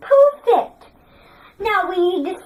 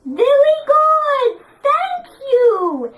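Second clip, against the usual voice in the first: excited praise; 6 semitones higher, slower than usual. Real, not acted. Praising class loudly.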